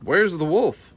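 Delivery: high, loud urgent shout. Acted. Farmer arriving at the pasture, loud high shout. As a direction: bellow it across the pasture. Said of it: "Farmer Woolsey shouted".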